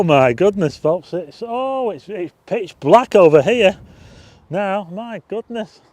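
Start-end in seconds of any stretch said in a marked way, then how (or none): none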